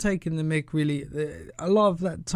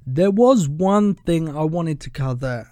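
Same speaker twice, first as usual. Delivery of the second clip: ordinary talk, nothing marked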